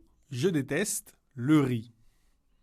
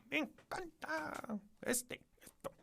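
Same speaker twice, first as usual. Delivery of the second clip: high, soft put-on voice of the pupil; a squeaky little voice playing the learner; mock student voice; 13 semitones higher, 15 dB quieter than usual. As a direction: pretend to be the student answering. Teacher voicing student answer.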